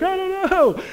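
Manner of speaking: high-pitched mock nervous giggler; put-on high voice, imitating an uncomfortable laugher; imitated awkward laughter. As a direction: squeal it as if laughing from discomfort. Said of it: "they're, like, laughing".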